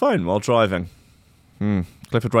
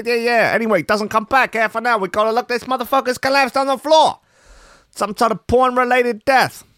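put-on gross Italian accent; 11 semitones higher than usual. Shop owner telling The Sun, in hammed-up Italian accent. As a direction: overdo a thick Italian accent, way up high. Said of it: "I'm gonna do a really gross Italian accent".

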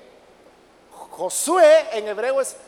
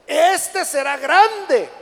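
very slow, louder and higher declaration; emphatic proclamation; 5 semitones higher, 6 dB louder, much slower than usual. Acted. Angel foretelling the child's greatness, proclaimed loud and slow. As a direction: stretch it out like a herald.

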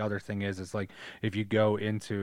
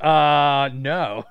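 flat refusal; 5 semitones higher, 10 dB louder than usual. Retold or imitated. Kid refusing.

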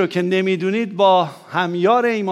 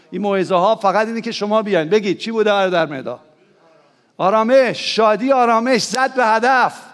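raised, lifted preacher voice; preaching at full force; rousing preaching; 4 semitones higher, 3 dB louder than usual. Acted. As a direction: fire up the congregation.

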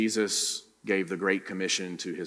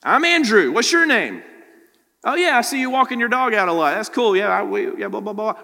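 loud, high-pitched chatty greeting; acted friendly small talk; 12 semitones higher, 10 dB louder than usual. Preacher showing how to greet a neighbour, eager acted small talk. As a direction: play an eager neighbour striking up a chat.

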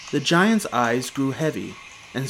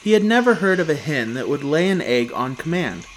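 ordinary delivery, nothing marked

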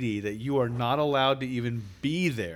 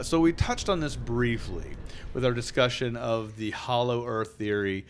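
ordinary talk

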